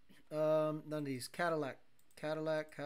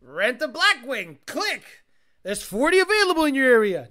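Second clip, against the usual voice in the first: mock ad voice; 4 semitones higher, 10 dB louder than usual. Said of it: "It needs to be like".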